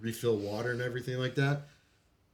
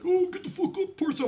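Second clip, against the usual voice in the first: mock bossy corporal; 3 dB louder than usual. Mocking corporal Nelson's order.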